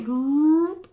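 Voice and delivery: high zooping sound effect; a quick high zoop like the headset switching on; imitated amp noise. Gamer describing his headset amp kicking in, high zoop noise. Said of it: "and the shit goes".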